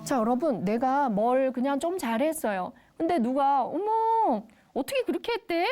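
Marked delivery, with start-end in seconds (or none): 3.6-5.7 high-pitched mock astonishment; gossipy-style surprise, pitched right up; wrong reaction. Shown as a demonstration. Someone reacting to another's success the wrong way, mock surprise. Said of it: "이게 아니라".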